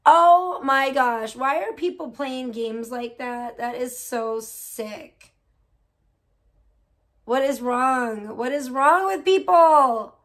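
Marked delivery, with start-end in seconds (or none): 0.1-1.3 stunned. Real, not acted. Reacting to chat story. 7.2-10.1 outraged. Real, not acted. Outraged at story.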